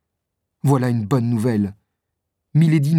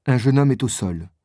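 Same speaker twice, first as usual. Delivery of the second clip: usual speaking voice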